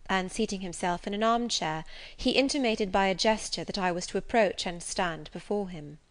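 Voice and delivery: ordinary delivery, nothing marked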